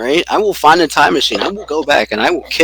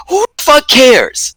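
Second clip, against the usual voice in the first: high, drawn-out indignant cry; exasperated outburst; 12 semitones higher, 4 dB louder than usual. Real, not acted. Turkey hater scoffing at fans of the bird, exasperated outburst. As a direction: throw it out in exasperation. Scornful outburst after mocking quote.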